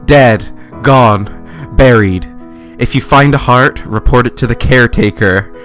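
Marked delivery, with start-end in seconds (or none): none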